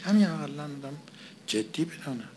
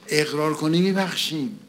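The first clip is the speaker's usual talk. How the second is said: louder, with the stress on میبخشیم; emphatic loud quote; 5 semitones higher, 7 dB louder than usual. Retold or imitated. Preacher repeating the words the man couldn't say, emphatic and loud. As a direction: push the line out with force.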